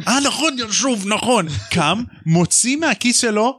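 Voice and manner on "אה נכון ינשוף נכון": high sudden remembering. Retold or imitated. Hagrid remembering the owl.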